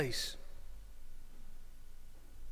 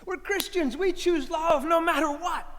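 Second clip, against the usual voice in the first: higher, insistent preaching; 5 semitones higher than usual. Acted. Preacher exhorting his congregation, insistent. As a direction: declare it to the flock.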